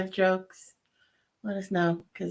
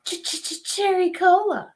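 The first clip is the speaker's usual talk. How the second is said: commercial jingle; 12 semitones higher, slower than usual. Retold or imitated.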